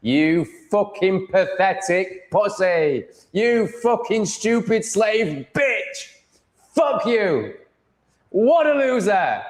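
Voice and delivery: high, drawn-out put-on jeering voice; mock taunting. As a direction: stretch out the insults in a high mock voice. Hurls mock abuse in put-on voice.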